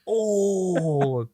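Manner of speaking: high, loud wail of disbelief; mock horror. Co-host teasing friend about his age, high mock wail. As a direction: wail the word in hammed-up horror.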